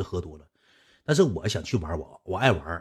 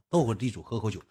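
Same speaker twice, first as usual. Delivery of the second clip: ordinary talk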